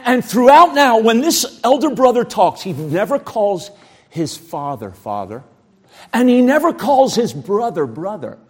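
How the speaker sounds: ordinary talk, nothing marked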